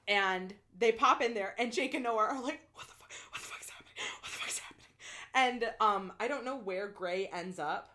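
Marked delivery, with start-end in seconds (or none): 2.7-4.9 hushed, breathless whisper; panicked whisper. Retold or imitated. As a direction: whisper it in disbelief. Jake and Noah panicked, stunned.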